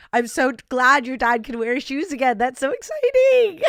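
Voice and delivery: high, loud excited squeal; gleeful. Real, not acted. Host squeals with joy over the dead dad's new shoes, loud and high. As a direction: burst with delight.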